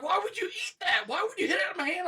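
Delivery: high, loud, fast indignant yell; mock outrage at a friend. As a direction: shriek it in disbelief. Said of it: "And I'm like".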